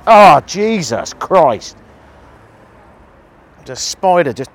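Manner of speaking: startled shriek, drawn out and loud; real fright, yelping at a spider; screaming at a spider. Real, not acted. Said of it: "I'm starting to sound like a nine-year-old girl".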